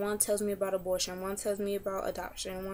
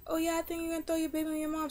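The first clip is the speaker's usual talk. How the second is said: higher, fast, mocking imitation of the doctor; 8 semitones higher, faster than usual. Retelling the doctor's warning to followers, in a high, quick mocking voice. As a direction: toss it off in a higher sing-song, mimicking her.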